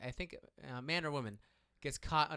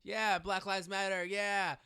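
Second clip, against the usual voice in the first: high, drawn-out mock cheer; raised-voice imitation of shouted support; imitated shouting; 7 semitones higher, 5 dB louder, much slower than usual. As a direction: mimic rowdy bros cheering along. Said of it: "so they're shouting".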